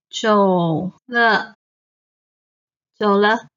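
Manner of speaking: 走了 with dipping third-tone 走 and a quick light 了; neutral tone. Shown as a demonstration. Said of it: "the is the neutral tone".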